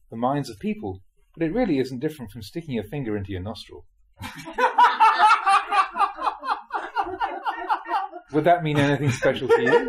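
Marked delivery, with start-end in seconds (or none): 4.2-8.3 long high burst of real laughter; big laugh. Real, not acted. Teacher laughing at his own joke to the listeners, big laugh. 8.3-9.9 louder, said through leftover laughter; laughing question. Real, not acted. Teacher teasing the listeners, laughing through the question.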